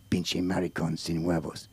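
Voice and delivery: very deep, soft, slow Mexican voice; low, drawn-out Mexican character voice; Mexican helper. Acted. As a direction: teach the Spanish phrase slowly in a low voice. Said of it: "That's my Mexican".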